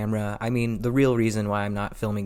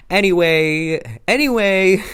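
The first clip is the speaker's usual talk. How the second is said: loud, high, drawn-out reset, building on the repeat; playfully overdone change of subject; exaggerated segue; 8 semitones higher, 6 dB louder than usual. Real, not acted. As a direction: push each one higher and louder to get back on track. Playfully resetting after a self-mocking tangent.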